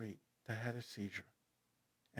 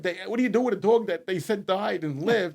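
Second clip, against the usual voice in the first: laughing; 6 semitones higher, 12 dB louder than usual. Real, not acted. Laughing while telling absurd twist.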